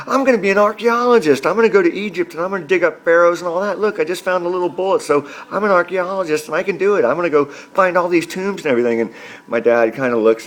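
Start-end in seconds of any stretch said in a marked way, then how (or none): 0.1-9.0 put-on high, excited boy voice; a boy sure he'll dig up pharaohs; eager kid. Son telling dad his big plan, high and eager. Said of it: "And I'm like".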